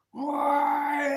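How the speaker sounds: high, loud drawn-out wail; mock drunken cry of why; drunken yell. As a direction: howl it out like a paralytic squaddie. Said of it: "And goes".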